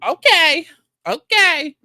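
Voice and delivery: high, loud mocking okay; loud sarcastic okay. Real, not acted. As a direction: belt it out like a sarcastic cheer. Sarcastic loud okays after rant.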